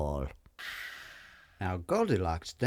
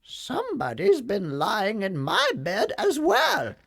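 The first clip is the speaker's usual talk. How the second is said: much higher mama bear; pitched-up mother bear; 12 semitones higher, 5 dB louder than usual. A narrator's reading. Mama Bear finds her bed used, high mama voice. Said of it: "said Mama Bear".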